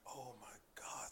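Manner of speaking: low, hushed reaction; quiet retold dismay; dismayed murmur. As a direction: say it under your breath in dread. Dismayed aside on seeing trouble.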